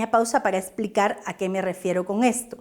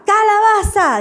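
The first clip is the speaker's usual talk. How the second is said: higher, louder chanted answer; children's playful reply; 8 semitones higher, 6 dB louder than usual. Shown as a demonstration. Children answering the teacher's call, loud and higher. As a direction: answer back like an eager class. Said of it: "dicen los niños".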